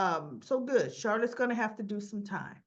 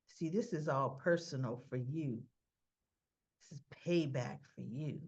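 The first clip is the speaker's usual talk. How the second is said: low, restrained accusing voice; quiet accusation; 8 semitones lower, 5 dB quieter than usual. Retold or imitated. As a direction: accuse him low and controlled. Valentine confronts Robert, retold.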